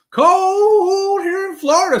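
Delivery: high, slow put-on voice; mock whiny quote. Vlogger mocking a viewer in Florida, high slow put-on whine. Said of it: "says Ryan".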